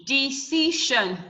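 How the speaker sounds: "Decision" slowly split into de, ci, sion; syllable by syllable. Shown as a demonstration. Teacher counting three syllables for the class, "Decision" slowly broken up. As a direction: sound out "Decision" in three slow beats. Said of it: "We have three syllable".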